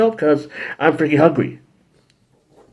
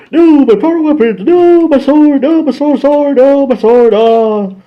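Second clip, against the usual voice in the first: high, loud whining over lost weapons; high whiny wail; 12 semitones higher, 6 dB louder, slower than usual. Retold or imitated. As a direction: wail like a cartoon hero robbed. Villain eats their weapons.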